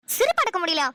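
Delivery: ordinary talk, nothing marked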